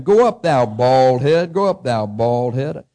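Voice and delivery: loud, slow, drawn-out taunt; mocking jeer. A narrator's reading. Children mocking the prophet on the road, loud slow taunting. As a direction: read it out as children jeering. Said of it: "mocked him".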